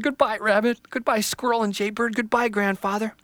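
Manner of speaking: put-on high, hurt young tree voice; a small tree on the verge of tears; upset little tree. Little Tree left behind, high and dismayed.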